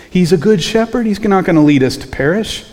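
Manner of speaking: high, insistent exclamation; emphatic conviction. Acted. Preacher voicing what grumblers should have remembered, emphatic conviction. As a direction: push it up high, as if it were obvious.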